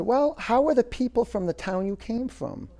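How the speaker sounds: wise man's question voiced high and light; lightly voiced sage, gently probing; wise old man. Wise man at the gate questioning a traveler, high and light.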